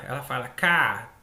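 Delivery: "cá" said with the z dropped; child clips "casa" to "cá"; a low child-like "cá", final syllable dropped; child's mispronunciation. Retold or imitated. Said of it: "ao invés de falar casa".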